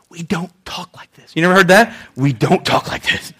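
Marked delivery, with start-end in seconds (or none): 0.1-1.3 stern scolding whisper; hushed, disapproving whisper; whispered reprimand. Acted. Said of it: "That's not what reprimand means". 2.2-3.3 low, soft voiced scolding; quietly disapproving, voiced; low reprimand. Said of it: "That's not what reprimand means".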